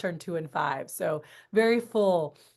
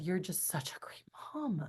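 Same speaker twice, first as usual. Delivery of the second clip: soft breathless whisper, much softer; whispered quote; 11 dB quieter than usual. Retold or imitated. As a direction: whisper it like a tender secret. Retelling sister's tender compliment.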